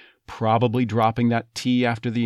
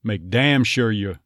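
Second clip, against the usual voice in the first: Texas accent. Shown as a demonstration. An accent coach demoing the phrase in Texas. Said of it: "You probably becoming ya".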